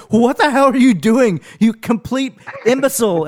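high, loud mock outrage; mock yell. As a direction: bark it at the teammate, hammed-up. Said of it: "they're like".

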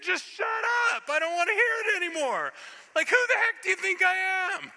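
loud, high, fed-up tirade; flipped-out angel. Acted. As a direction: yell like an angel who has had enough. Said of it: "the angel Gabriel flipped out".

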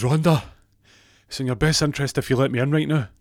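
loud, high, urgent demand; acted threat. [name] threatens receptionist Rhonda over the buzzer, acted threat.

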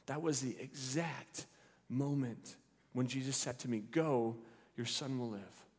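soft, slow-starting wonder; hushed realization. Acted. Father recalling Jesus's words to him, hushed wonder. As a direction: say it softly as it sinks in.

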